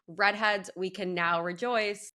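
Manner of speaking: scripted product list, easing off at the end; ad read. Acted. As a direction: list the products like a sponsor spot. Host lists products in ad.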